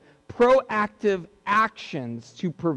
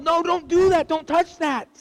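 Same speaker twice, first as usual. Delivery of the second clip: yelled warning; 8 semitones higher than usual. Retold or imitated. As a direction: snap it out high to stop the child's hand. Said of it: "Even if you did it in a yell".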